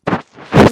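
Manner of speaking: sudden loud burst of laughter. Real, not acted. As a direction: crack up loudly.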